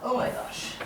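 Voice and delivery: breathless whispered oh my gosh; whispered gasp. Real, not acted. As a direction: whisper it in sudden alarm. Bed sinking, panicked under breath.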